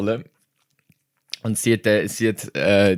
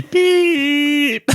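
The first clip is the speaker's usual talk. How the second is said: imitated censor beep. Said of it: "so ein Piep-Geräusch rein".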